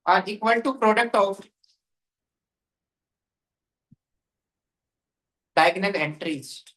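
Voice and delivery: very slow, drawn-out words for note-taking; slow dictation. Real, not acted. Teacher dictating a matrix rule to students, slow dictation. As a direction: dictate phrase by phrase with long pauses.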